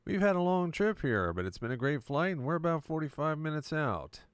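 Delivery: upbeat airline pilot on the intercom; cheerful captain announcing the arrival; pilot announcement. Retold or imitated. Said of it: "when the pilot comes on and says".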